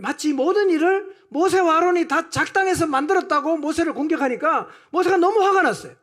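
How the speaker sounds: loud, high-pitched pulpit delivery; fired-up sermon; impassioned preaching. Real, not acted. Preaching at full force.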